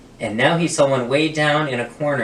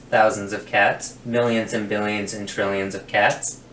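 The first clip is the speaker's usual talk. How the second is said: usual speaking voice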